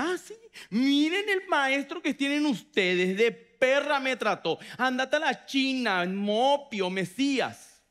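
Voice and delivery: loud, high, slow mocking rant; indignant offended woman. Offended woman mocks Jesus to his disciples, loud indignant rant. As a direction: storm off insulted and shout it back at them. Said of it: "y hacía".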